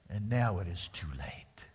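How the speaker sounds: hushed, sorrowful whisper; preacher mourning the lost chance, whispered. Real, not acted. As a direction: breathe it out, grieving. Jesus weeping.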